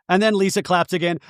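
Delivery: ordinary delivery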